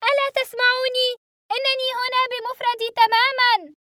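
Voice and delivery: high, loud plea; desperate shout. A narrator's reading. Boy shouting to unseen pilots, desperate. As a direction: yell that you're all alone. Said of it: "صارخًا".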